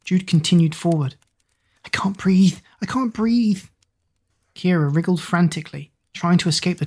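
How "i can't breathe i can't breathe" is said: breathless panic, rising higher; gasping, trapped and scared; panicked. Acted. Stuck and panicking in tunnel.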